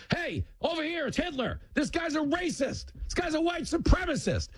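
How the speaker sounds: very high-pitched hammed-up alarm; mock accuser calling out. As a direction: cry accusations down the street in a silly high voice. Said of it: "started walking down the street going".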